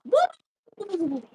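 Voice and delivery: whoop. Retold or imitated. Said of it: "I go".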